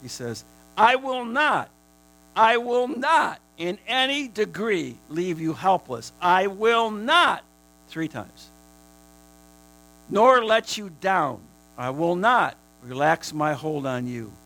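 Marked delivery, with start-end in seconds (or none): 0.8-7.4 higher, louder, slow proclamation; preaching at full force. Acted. Preaching God's triple promise. 10.1-14.3 loud emphatic proclamation; preaching. Acted. Preaching God's promise.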